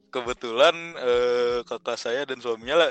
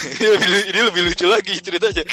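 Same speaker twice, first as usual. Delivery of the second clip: real laugh, higher and louder; 7 semitones higher, 10 dB louder, faster than usual. Real, not acted. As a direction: burst out laughing while telling it. Cracking up at own story. (tertawa sambil bercerita)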